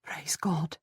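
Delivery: soft, quiet thanks. A narrator's reading. Wife thanks God for the news, quietly. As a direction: say it softly, under strong feeling. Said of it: "I say quietly".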